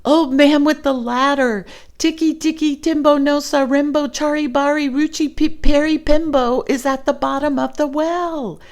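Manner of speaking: high, loud, fast last-breath yell; shouting. Acted. Chang shouting to the old man with his last breath, high and loud. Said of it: "he shouted".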